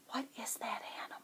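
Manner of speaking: whisper. Acted. Reader asks children conspiratorially.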